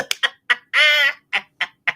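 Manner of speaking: real burst of high laughter; cracking up, high and loud. Real, not acted. Laughing at panda joke.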